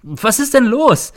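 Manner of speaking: high, drawn-out, loud cry; loud, desperate demand to know; shouting. A narrator's reading. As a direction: shout the question in alarm. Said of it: "schreie ich".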